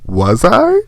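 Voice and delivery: high, coy, sassy little question; mock-coy "Was I?" with a high lilt. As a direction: toss it back with sass. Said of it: "And that's the sass".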